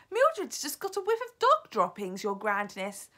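high, loud reply falling at the end; calling back. A narrator's reading. Witch reporting to Her Grandness, calling back. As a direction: call back up to the leader. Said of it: "someone called back to her".

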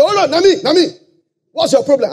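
high, brash pidgin reply; pushy questioner. Retold or imitated. Preacher voicing the rich man answering Jesus, brash. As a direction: shout back cheekily na me.

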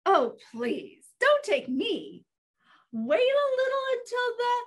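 lightly voiced goat begging; plead, rising as you bargain; medium-sized goat's plea; pleading middle goat.

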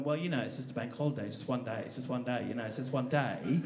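mild-mannered English voice, playing it down; English accent. Comic imitating the English on bank holidays, reasonable English accent. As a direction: put on an understated English accent. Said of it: "the English are very good at going".

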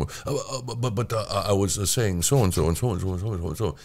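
soft, hesitant, stammering Northeastern gentleman; quiet patrician mumble-through of scripted lines; William F. Buckley. Shown as a demonstration. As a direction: stammer through the lines in a hushed accent impersonation. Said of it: "I do my lines like this".

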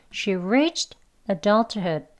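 each word drawn out and spaced; slow. Shown as a demonstration. Teacher modelling the sentence slowly for learners. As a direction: read it out very slowly. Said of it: "한 번 더요".

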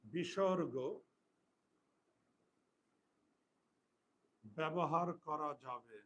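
slow, word by word for pupils to write; teacher dictating a rule, slow and spaced; slow dictation. Shown as a demonstration. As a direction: dictate it slowly with pauses.

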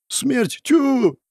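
high-pitched dismissive pooh-pooh; exaggerated scornful bravado; scoffing. A narrator's reading. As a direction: toss it off in a squeaky, mocking pitch. Narrator scoffs at Death.

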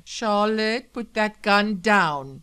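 loud, slow, urgent command; alarmed order. Acted. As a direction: urge her to put the gun down. Husband faces a gun.

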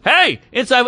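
high, sudden scolding call; yelled reprimand. Retold or imitated. Man cutting in as the girls get loud, yelled reprimand. Retold man yelling again.

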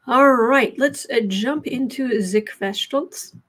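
slow, playful mock German accent; hammed-up German delivery; fake German accent. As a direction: put on a silly German accent, drawn out. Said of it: "I suddenly have a fake German accent".

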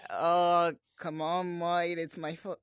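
slightly hammed-up British pleading; mock British whine; put-on British accent. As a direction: plead like a caricature Brit, drawing out the end. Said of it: "when I do that voice".

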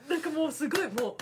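ordinary delivery

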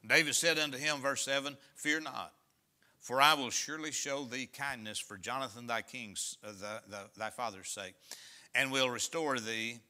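solemn pulpit reading; scripture reading. Acted. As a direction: read the verse out to the congregation. Reads scripture aloud.

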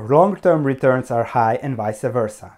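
usual speaking voice

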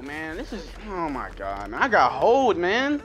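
normal talking voice

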